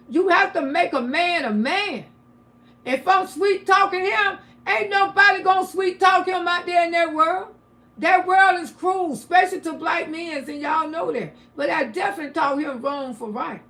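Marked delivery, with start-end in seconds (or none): 0.1-10.4 louder and higher, pounding each point; a mother's fierce lecture, nearly shouting; emphatic near-shout. Real, not acted. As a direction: hammer it home like a sermon. Preaching about raising strong sons.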